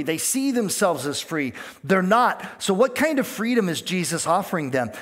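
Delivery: ordinary talk, nothing marked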